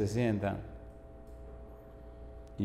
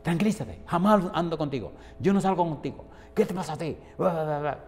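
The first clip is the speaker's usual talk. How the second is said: higher-pitched mocking scolding; mock telling-off in a raised pitch; mimicked annoyed friend; 6 semitones higher than usual. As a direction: imitate a friend's indignant rant, pitched up. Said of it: "y la otra le dice".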